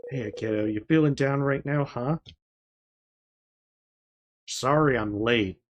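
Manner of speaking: lightly voiced dad, tender and easy; gentle father. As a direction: lift her up kindly. Father comforts daughter.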